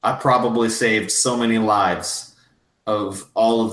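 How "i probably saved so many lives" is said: usual speaking voice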